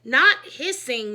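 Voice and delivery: loud, slow, firm NOT hissing; correcting stress. A narrator's reading. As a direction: lean hard on the correction, slowly. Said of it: "Miss Krupp said".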